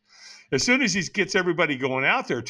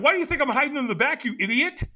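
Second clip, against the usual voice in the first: fast, high-pitched exasperation; mock-indignant retort, pitched up and rushed; ticked off; 10 semitones higher, faster than usual. Caught hiding in the back by helper, ticked off. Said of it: "now I'm kind of ticked off".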